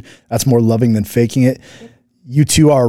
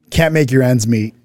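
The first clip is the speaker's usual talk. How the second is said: ordinary talk, nothing marked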